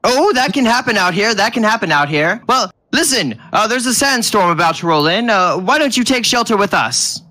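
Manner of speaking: friendly tiny fellow rattling along; little bald man. Acted. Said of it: "As he goes".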